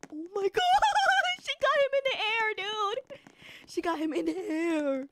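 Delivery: stunned, high-pitched exclaiming; amazed. Real, not acted. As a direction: wail it in astonishment. Amazed at a kill in game.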